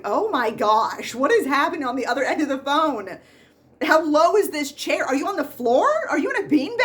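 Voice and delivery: loud, high, incredulous mock outburst; exasperated disbelief. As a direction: pile up the questions in comic disbelief. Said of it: "I'm like".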